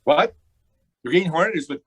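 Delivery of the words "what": loud, high, sudden question; startled. Acted. Gangster told the Hornet was at Grayson's, startled.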